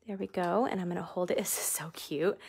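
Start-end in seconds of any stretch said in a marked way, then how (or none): 1.4-2.4 whisper. Real, not acted. Delighted aside.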